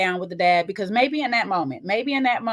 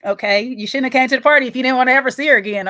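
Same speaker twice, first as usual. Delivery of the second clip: ordinary talk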